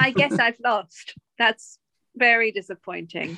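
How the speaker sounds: slow, wooden resignation; underwhelmed defeat. Retold or imitated. Co-host imitating the actress as she loses, underwhelmed defeat. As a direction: lose with hardly any reaction. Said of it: "when she loses and she's just sort of like".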